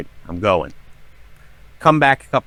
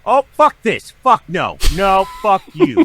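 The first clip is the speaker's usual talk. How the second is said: high, loud indignant yell; retold yelling; 9 semitones higher, 5 dB louder than usual. Storyteller replaying how he yelled at the haunted TV, high loud yelling. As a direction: yell it out, high-pitched and fed up. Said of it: "march over there yelling".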